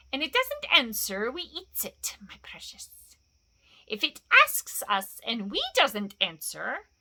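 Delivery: whispering, hissing, then rising sharply; wheedling creature bargaining over a meal; Gollum's creature voice. Acted. Gollum threatens to eat Bilbo, hissing creature.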